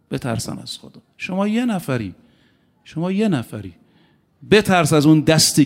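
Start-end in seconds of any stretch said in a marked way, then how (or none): 0.1-1.0 hushed warning. Shown as a demonstration. A preacher warning rivals to fear God, in a hushed voice. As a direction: let the warning sink to near silence. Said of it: "ولی بترسن از خدا".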